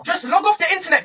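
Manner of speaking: ordinary talk, nothing marked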